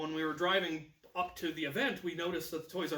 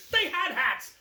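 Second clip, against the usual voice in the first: put-on high, loud breathless girl's report; mock-excited girl shouting about pirates; 11 semitones higher, 9 dB louder, slower than usual. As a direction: squeal it like a thrilled witness. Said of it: "and they said".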